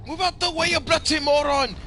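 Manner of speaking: high, loud mock Irish yell; thick Irish accent. Brother screaming at another driver, angry Irish yell. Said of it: "this thick Irish accent".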